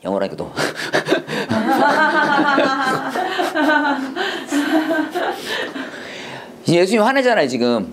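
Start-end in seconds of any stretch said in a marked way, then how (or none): 0.6-6.4 a long burst of high laughter, nearly crying; long helpless laugh. Real, not acted. Teacher cracks up at slipping back into English, laughing hard. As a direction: crack up and can't stop laughing. Laughs at her own slip.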